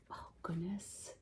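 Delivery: soft unvoiced whisper; hushed mock-dismay whisper; whispered aside. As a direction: whisper it as if shocked. Whispered aside reading to kids.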